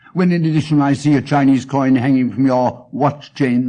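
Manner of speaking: usual speaking voice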